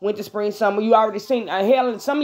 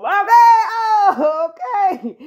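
high wavering fake sob on okay; hammed-up weepy okay; mock crying; 6 semitones higher, 4 dB louder, slower than usual. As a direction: pretend to cry it out. Mock-crying after the hug line.

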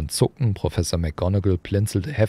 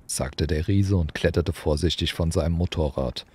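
ordinary delivery, nothing marked

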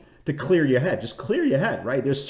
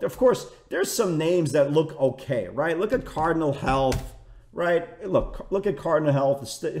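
ordinary talk

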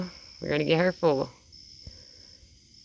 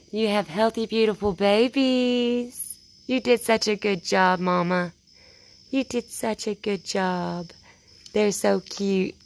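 higher, slow, cooing praise; 4 semitones higher than usual. Real, not acted. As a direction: coo it like talking to a pet. Praising raccoon mother, baby talk.

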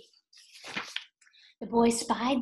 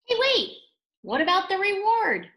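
sudden surprise; 9 semitones higher, 7 dB louder than usual. Acted. Reader suddenly remembers the reward.

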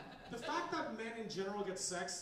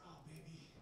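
loving movie line; 2 semitones lower, 10 dB quieter than usual. Retold or imitated. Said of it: "she looks at him lovingly and says".

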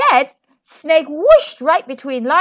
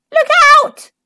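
high, loud squawk; squawking shout; 10 dB louder than usual. A narrator's reading. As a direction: squawk it out in alarm. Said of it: "Ostrich squawked".

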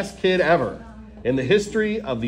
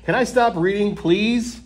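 higher, begging tone; pleading; 6 semitones higher, 3 dB louder than usual. Acted. Reader begging the kids to stop, pitched high. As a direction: beg to be let off. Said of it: "Is this whole book a trick?".

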